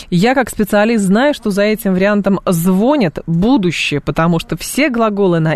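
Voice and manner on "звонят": «звонят» stressed ЗВО-нят; first syllable of «звонят» carries the stress, falling after. Shown as a demonstration. Host explains the coming variant, «звонят» stressed on ЗВО. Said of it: "будет на первый слог".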